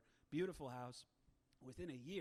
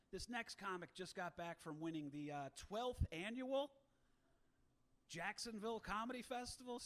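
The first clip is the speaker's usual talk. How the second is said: hyped MC climbing much higher on the twelfth annual; announcer intro; 5 semitones higher than usual. Retold or imitated. MC introducing the comic to the crowd, hyped and high. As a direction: stretch twelfth annual out big like a showman.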